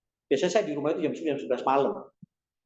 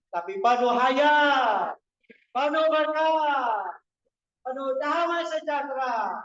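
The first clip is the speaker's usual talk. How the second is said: high, drawn-out rallying proclamation; preaching climax; 8 semitones higher, slower than usual. Real, not acted. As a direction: proclaim each blessing high, stretching the final words. (khotbah berseru dengan nada tinggi dan panjang)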